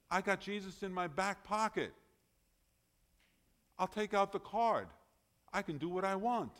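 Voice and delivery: mock a smug believer who treats grace as a free pass; cocky believer imitation. Voicing a careless, presumptuous believer.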